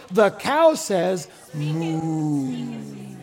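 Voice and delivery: low, very slow pull-string toy voice; slow toy voice with a long, low moo; talking toy imitation. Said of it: "And you pull the string, and it goes".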